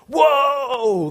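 high, loud cry of shock; shocked whoa. Retold or imitated. Preacher acting out shock at a friend, high loud whoa. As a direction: gasp it out like your jaw dropped.

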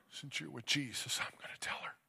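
soft, breath-only whisper; tender whisper. Retold or imitated. Father speaking to his daughter who is with Jesus, whispered. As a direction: whisper it privately to a lost child.

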